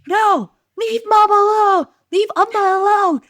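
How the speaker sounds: put-on high, loud child's shout; imitated kid yelling at a sibling; King. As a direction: holler protectively like a small boy. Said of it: "I hear King going".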